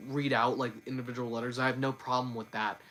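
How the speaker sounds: ordinary talk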